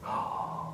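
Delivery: soft mock gasp of surprise; taken-aback gasp. Batman hearing the name, taken-aback gasp. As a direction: imitate a sharp, quiet intake of breath. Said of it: "like, taken aback".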